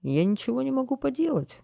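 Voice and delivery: high, plaintive protest; sorrowful helplessness. A narrator's reading. Garbage man tells townspeople he is powerless, sorrowful. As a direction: plead helplessly, pitched well up. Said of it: "горестно разводил руками мусорщик".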